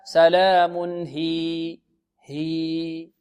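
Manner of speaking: very slow "سلام هي" with long level "hiii"; lengthened stop. Shown as a demonstration. Teacher concludes how to stop, long vowel. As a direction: sustain the final vowel of "هي" evenly. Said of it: "إذن الوقف عليها".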